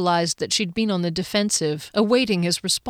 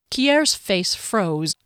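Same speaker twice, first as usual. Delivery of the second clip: ordinary delivery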